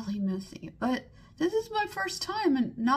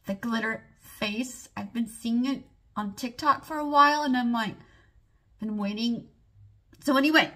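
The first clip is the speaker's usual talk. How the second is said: ordinary talk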